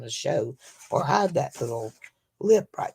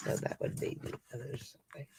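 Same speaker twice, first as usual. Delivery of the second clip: whispered to herself; breathy whisper under her breath; whispered aside; 4 semitones lower, 14 dB quieter, faster than usual. Real, not acted. Muttering to herself.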